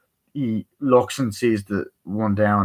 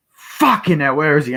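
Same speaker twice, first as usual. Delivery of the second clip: acted exasperation; 6 semitones higher, 5 dB louder than usual. Acted chase, losing the man.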